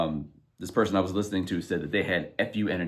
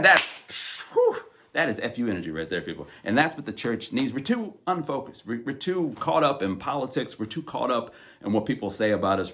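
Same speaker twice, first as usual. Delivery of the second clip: normal talking voice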